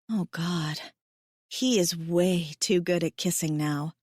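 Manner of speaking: usual speaking voice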